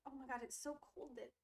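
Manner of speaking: ordinary talk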